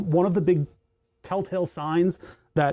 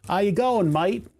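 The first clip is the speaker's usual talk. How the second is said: lightly put-on Aussie greeting; mock Australian, a little higher; Australian accent; 4 semitones higher than usual. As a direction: try out a casual Australian accent. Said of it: "Doing the shittiest Australian accent".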